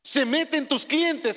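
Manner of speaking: ordinary delivery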